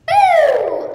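high, loud jump-scare Boo; sudden scare shout. Acted. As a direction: startle them out of nowhere. Little sister startles listener.

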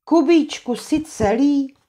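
high, anxious call; worried call. Acted. Anče calls to Kuba after the blast, scared. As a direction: cry out for him in fear.

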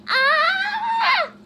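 short high shriek; shocked scream. Real, not acted. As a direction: yelp in horror. Second scream at clip.

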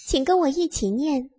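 usual speaking voice

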